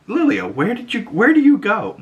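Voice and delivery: high, puzzled calling out; mock puzzled coven. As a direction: ask where she drifted. Coven asking Lilia.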